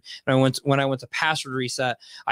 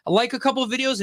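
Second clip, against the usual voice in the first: excited; 10 semitones higher than usual. Real, not acted. Hyped reply to friend.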